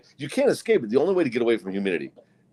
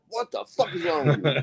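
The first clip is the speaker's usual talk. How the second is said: higher-pitched, put-on indignation; mock outrage; 8 semitones higher than usual. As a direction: scold the dog in hammed-up disbelief. Mock outrage at the farting dog.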